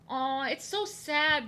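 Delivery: high, slow cooing aww; aww of pity. Real, not acted. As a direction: coo with pity. Cooing over animals.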